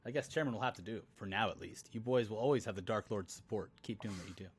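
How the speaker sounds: low, soft menacing voice; Dark Lord voice. Retold or imitated. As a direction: read it as a brooding dark lord. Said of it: "Sauron took the chairman spot".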